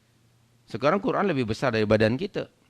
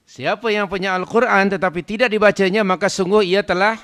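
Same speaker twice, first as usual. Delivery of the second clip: loud, raised-pitch sermon delivery; forceful preaching; 7 semitones higher, 9 dB louder than usual. Real, not acted. Preacher warning those who own a Quran unread, forceful preaching. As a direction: drive the warning home to the congregation.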